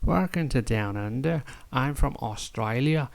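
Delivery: put-on Australian with swooping pitch; Australian accent. Greeting visitors to Down Under in an Australian accent. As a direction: play up an Australian accent, softly.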